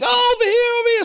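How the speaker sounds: high shout calling out; screaming crowd. Retold or imitated. Crowd at the store shouting for free bags, high yell. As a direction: yell to get the bags thrown your way.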